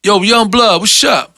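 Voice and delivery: a lightly voiced stranger hailing him through the window; stranger's greeting. Mimicking stranger calling through window.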